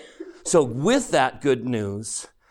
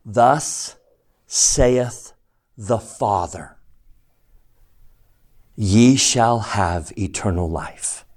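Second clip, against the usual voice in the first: solemn scripture reading; 4 semitones lower, slower than usual. Acted.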